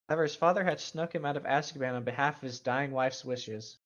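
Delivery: normal talking voice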